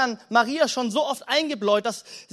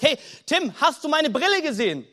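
higher, frazzled and irritated asking; stressed and annoyed; 4 semitones higher than usual. Acted. Glasses wearer asks Tim for lost glasses, stressed and annoyed. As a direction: act out someone flustered, hunting for their glasses. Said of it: "völlig gestresst und aufgelöst und genervt".

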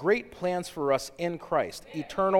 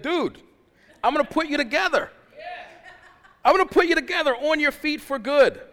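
high, fast, laughing buddy-God; casual God voice; 11 semitones higher, 8 dB louder than usual. Retold or imitated. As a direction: play God as an easygoing friend, chuckling. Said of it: "he's like, he's like".

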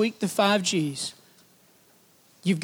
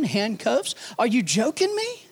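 ordinary talk

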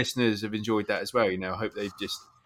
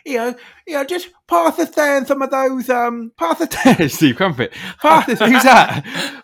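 high, loud hammed-up TV chef; mock celebrity chef voice; 11 semitones higher, 8 dB louder than usual. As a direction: send up a cheerful TV cook. Mocking Jamie Oliver posh chef voice.

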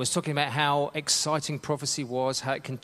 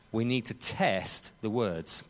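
ordinary delivery

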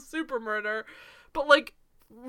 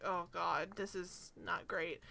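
muttered dismay; 6 semitones lower, 12 dB quieter, slower than usual. Retold or imitated. Cringing at a bad accent.

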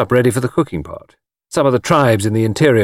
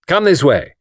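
higher, guiding call; brisk; 7 semitones higher, 4 dB louder than usual. A narrator's reading. Anthony leading the tour party, brisk. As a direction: herd the party along. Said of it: "said Anthony briskly".

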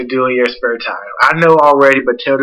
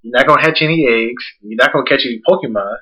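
ordinary delivery

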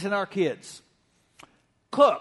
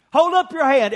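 raised, higher call for hands up; loud call to the congregation; 6 semitones higher, 5 dB louder than usual. Real, not acted. Pastor calls every campus to raise hands, loudly. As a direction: call out to the whole room. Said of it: "every campus now".

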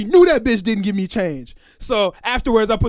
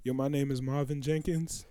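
quiet, flat-toned, much softer; calm intro; 5 semitones lower, 14 dB quieter than usual. Retold or imitated. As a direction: say it plainly and low in volume. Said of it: "He said".

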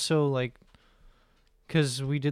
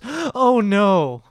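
dismayed lady; 7 semitones higher, 11 dB louder than usual. Retold or imitated. Lady groans at Hawking joke.

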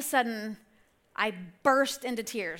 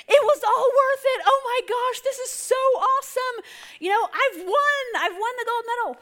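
high, loud, ecstatic; overjoyed parent; 11 semitones higher, 5 dB louder than usual. Retold or imitated. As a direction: shout for joy like the winner's mom. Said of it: "She said".

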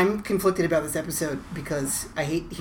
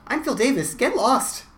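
quick, higher-pitched sign-off; 6 semitones higher, 4 dB louder than usual. Real, not acted. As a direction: rattle off the sign-off fast. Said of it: "You said that very quickly".

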